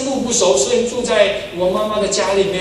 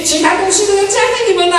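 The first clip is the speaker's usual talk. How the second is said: high, loud, fast preaching; preaching at full force; 13 semitones higher, 4 dB louder, faster than usual. Real, not acted. Preacher proclaiming to the congregation, high and loud. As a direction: drive the promise home to the congregation.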